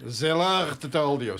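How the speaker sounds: mock Russian-accented "the Lord told you", slow; slow, mock-solemn Russian accent. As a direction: drop into a heavy Russian accent, slowly. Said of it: "sounds like a Russian accent probably".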